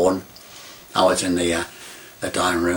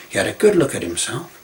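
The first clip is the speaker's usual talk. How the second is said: ordinary talk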